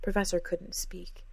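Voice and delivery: ordinary delivery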